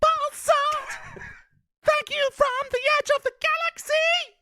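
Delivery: very high shouted rock wail. Pretend. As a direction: shriek it out like a high rock singer. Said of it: "can I get some [name]?".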